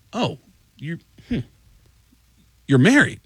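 puzzled "Oh, you're... hmm", long gaps before "you're married?"; hesitant pauses. Retold or imitated. Tech learning "you're married", awkward halting pauses. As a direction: halt after "you're", hum, then lift "married" in surprise. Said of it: "the guy just paused and went".

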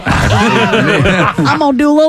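comic country hillbilly come-on; hillbilly accent. Acted. Co-host performs the accent as mock seduction, hillbilly. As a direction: ham it up in a hillbilly Southern accent. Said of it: "I like the hillbilly one".

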